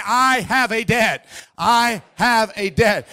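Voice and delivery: slow, evenly spaced words; call-and-repeat. Shown as a demonstration. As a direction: lead the congregation word by word. Leads congregation in repeating words.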